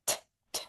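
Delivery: "T" as a bare tongue-tap puff, no voice; voiceless T. Shown as a demonstration. Teacher has learners repeat the sound, voiceless whispered "T". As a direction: release "T" softly with air only. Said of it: "Do not use your voice".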